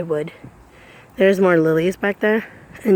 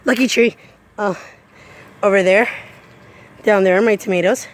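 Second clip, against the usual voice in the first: normal talking voice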